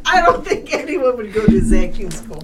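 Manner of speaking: laughing. Real, not acted. Laughing after the joke.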